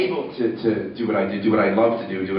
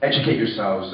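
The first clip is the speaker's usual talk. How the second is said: normal talking voice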